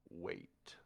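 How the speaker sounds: breathy hushed whisper; whispered wait. Acted. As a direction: hiss it under the breath as a signal. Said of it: "he says".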